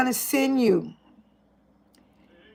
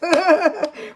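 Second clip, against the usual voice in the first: high happy laugh; bright burst of laughter; delighted laugh; 6 semitones higher than usual. Real, not acted. Celebrating Amy.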